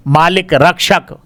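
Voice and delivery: normal talking voice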